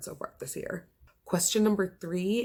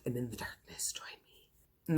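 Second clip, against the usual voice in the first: hushed, breathy spooky whisper; a creepy conspiratorial whisper, luring the listener in; 3 semitones lower, 9 dB quieter than usual. Pretend. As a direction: beckon them into the dark in a whisper. Spooky invitation into the dark book.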